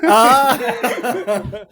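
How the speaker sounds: high, loud burst of laughter; real, sudden laugh. Real, not acted. Laughing at the previous joke.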